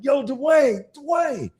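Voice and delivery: high called-out name across a parking lot; calling out. Retold or imitated. Coach calling a player across a mall parking lot, high shout. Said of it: "and I yell, I'm like".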